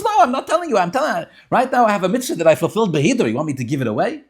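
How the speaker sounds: high, loud argumentative retort; acted rebbe digging in his heels; emphatic refusal.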